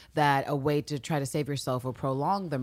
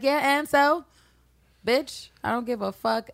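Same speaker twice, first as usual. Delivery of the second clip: dismissive killer; 9 semitones higher than usual. Retold or imitated. Villanelle's dismissive reply quoted.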